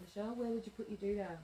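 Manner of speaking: ordinary talk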